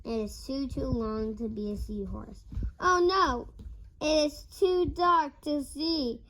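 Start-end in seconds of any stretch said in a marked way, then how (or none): 2.8-6.2 higher and louder, reading with alarm; child reading a scary turn with mild alarm; dramatic alarm. Acted. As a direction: read it like danger is near. Child reading tense moment.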